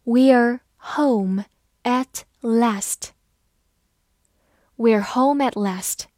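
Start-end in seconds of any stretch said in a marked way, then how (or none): 0.0-3.1 very slow, word by word; slow, deliberate teaching pace; slow reading. Shown as a demonstration. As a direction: read it slowly with gaps between words. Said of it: "好，慢读一遍".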